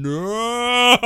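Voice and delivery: drawn-out, high, loud "No!"; acted horrified cry. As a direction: wail it in mock horror at your partner. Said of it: "turning his head to face his partner, going".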